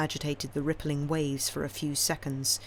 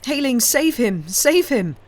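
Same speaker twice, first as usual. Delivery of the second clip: desperate pleading; 8 semitones higher, 8 dB louder than usual. A narrator's reading. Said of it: "said the old man".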